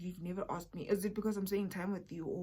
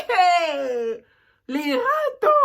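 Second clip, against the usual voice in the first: high, loud shout of a name; calling out; 8 semitones higher, 15 dB louder, much slower than usual. Real, not acted. She shouts out to Lerato, laughing. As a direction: yell across the room.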